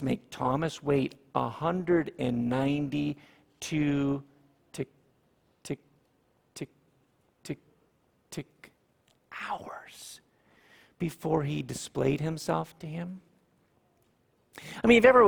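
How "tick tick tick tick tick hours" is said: whispered tick tick, then a whispered "hours"; ticking clock. Shown as a demonstration. Preacher imitates the clock during the wait, whispered. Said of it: "mimics a ticking clock".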